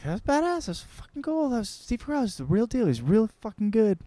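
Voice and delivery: high, thrilled gush about Steve Carell; excited gushing. Retold or imitated. Friends raving after the show, high and thrilled. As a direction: gush like starstruck fans.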